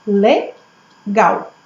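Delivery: "Legal" split as Le, pause, gal; two separate beats on "Legal"; teacher sounding out "Legal"; syllable by syllable. Shown as a demonstration. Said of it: "Quantas vezes eu abro a boca".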